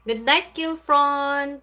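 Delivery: slow loud high goodnight; drawn-out goodnight. Real, not acted. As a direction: stretch the goodnight out to the viewer.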